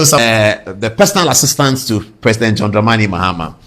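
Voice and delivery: usual speaking voice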